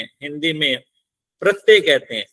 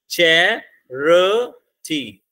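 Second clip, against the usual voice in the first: Charity very slowly in three separate beats; broken into syllables; much slower than usual. Shown as a demonstration. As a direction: sound out charity as चै, र, टी with pauses. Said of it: "syllable बोल के देख लो".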